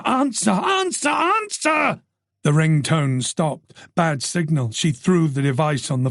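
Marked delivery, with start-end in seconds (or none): 0.0-2.0 high, rising cries at the phone; frantic repeated demand; frustrated demand. Acted. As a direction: plead and snap at a dead phone. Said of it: "banged the mobile in frustration".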